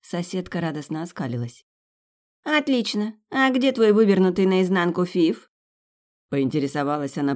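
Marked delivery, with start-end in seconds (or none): 2.4-5.5 scornful. Acted. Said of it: "презрительно морща носик".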